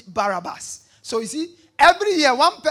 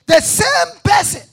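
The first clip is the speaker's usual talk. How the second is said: shout; 9 semitones higher, 12 dB louder, slower than usual. Acted. Preaching climax.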